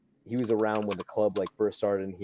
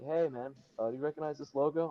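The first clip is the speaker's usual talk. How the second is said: rushed, quieter, fast question; nervous; 6 semitones higher, 6 dB quieter than usual. Retold or imitated. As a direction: blurt it out nervously. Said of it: "I'm, like, all nervous".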